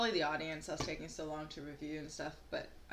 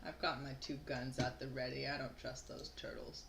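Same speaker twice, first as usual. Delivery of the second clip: softer, lowered in-character aside; low-key repeat; 4 semitones lower, 9 dB quieter than usual. Acted. Player repeating Jackie's line about the turtles after "Sorry?", quiet and low. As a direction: say it again quietly, trailing softer.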